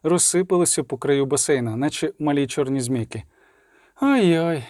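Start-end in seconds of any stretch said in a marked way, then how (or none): 4.0-4.6 ой-ой in a changed, sultry-sounding colour; teasing oh-oh again. A narrator's reading. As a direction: repeat ой-ой as a lazy tease. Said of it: "сказала Єннефер". (знову лукаве ой-ой)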